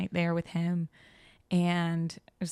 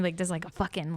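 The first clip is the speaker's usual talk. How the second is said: ordinary talk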